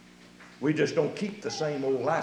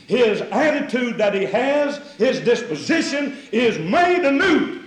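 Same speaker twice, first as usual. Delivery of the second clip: loud, high, slow proclamation; full-force preaching; 7 semitones higher, 7 dB louder, slower than usual. Acted. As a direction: proclaim it to the whole church.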